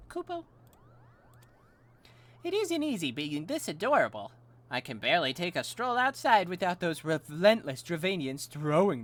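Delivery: high-pitched, swooping, self-adoring creature; Mogmug, vain Moogle. Acted. Adorable Moogle complains of overbearing admirers, high and haughty. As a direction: play it preening and put-upon by admirers.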